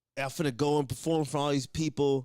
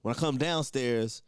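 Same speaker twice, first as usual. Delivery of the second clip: normal talking voice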